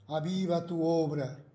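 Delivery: usual speaking voice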